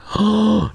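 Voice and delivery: a loud gasp shooting way up; sharp high excited gasp. Real, not acted. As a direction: gasp like you just spotted the lost cat. Excited gasp at finding cat.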